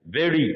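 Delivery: वेरी with the r tapped like a quick ड; वेरी, the r flicked off the roof of the mouth; flapped r. Shown as a demonstration. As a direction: say वेरी with a light ड-like flap. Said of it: "ये ड में convert हो जाता है".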